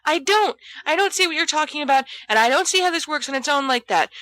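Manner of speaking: high, fast, trembling protest; quivery outburst. A narrator's reading. Peter begs his friends to quit, quivering and high. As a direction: Peter pleads to abandon the plan, high and fast. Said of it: "so quivery".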